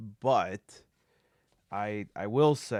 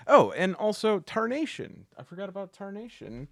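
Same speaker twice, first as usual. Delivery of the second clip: sudden recall; 10 semitones higher, 4 dB louder than usual. Real, not acted. Suddenly remembers a forgotten game.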